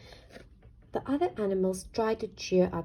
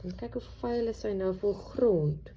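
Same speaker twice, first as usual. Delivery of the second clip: usual speaking voice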